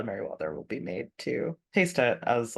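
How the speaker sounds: ordinary talk, nothing marked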